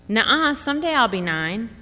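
put-on a stubborn kid insisting; lightly voiced kid, rising on the end; child protesting. Claire protesting to sister.

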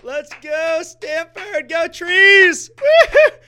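high, loud cheering shouts; wild victory whoops. Real, not acted. Radio host cheering on air as Stanford beats Oregon, wild whoops. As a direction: whoop and holler for the win like a crazed fan.

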